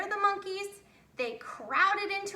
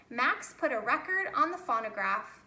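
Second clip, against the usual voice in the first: plain reading voice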